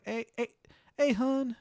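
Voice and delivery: high Baltimore hey hun; Maryland accent. Retold or imitated. Staff dropping the act with a Maryland accent. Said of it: "Maryland accents".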